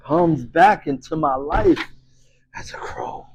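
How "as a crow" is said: low hushed whisper; whispered reveal. Acted. Narrator revealing the brother's return, whispered. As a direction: breathe it as a dark secret.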